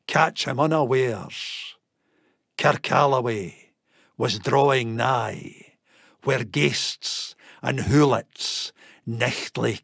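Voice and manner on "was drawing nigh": ordinary delivery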